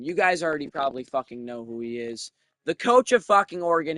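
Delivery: usual speaking voice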